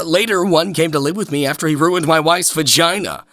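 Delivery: ordinary talk, nothing marked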